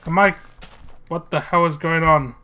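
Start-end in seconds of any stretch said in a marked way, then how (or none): none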